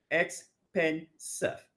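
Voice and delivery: expensive drawn out slowly; slow syllable demo. Shown as a demonstration. Teacher repeating expensive slowly for counting. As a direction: say expensive slowly, one beat per syllable. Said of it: "about three syllables there".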